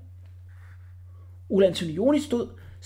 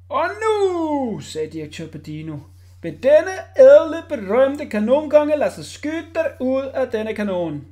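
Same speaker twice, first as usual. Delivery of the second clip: higher, slow grand; ringmaster announcing; 7 semitones higher, 7 dB louder, slower than usual. A narrator's reading. Director presenting the cannon king, grand. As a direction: roll out the big act.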